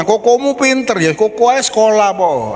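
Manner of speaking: put-on high, whiny child comparing himself to his brother; child's voice. Child envies older brother. (suara anak merajuk, nada tinggi)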